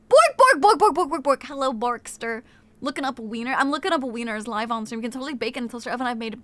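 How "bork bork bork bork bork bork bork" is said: high loud playful barks; dog barking. Pretend. Streamer greeting Borkster with mock barking. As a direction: bark like a little dog.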